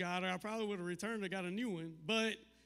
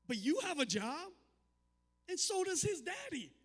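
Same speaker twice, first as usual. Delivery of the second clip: daughter voice; 10 semitones higher than usual. Retold or imitated. Daughter's logic voiced by dad.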